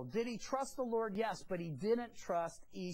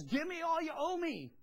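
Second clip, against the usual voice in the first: higher-pitched, acted angry demand; choking demand; 8 semitones higher, 4 dB louder than usual. Forgiven servant choking the guy who owes him, demanding. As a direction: play a creditor shaking down his debtor. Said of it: "choking the guy".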